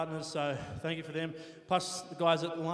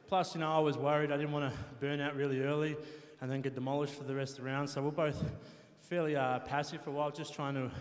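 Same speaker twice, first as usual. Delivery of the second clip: usual speaking voice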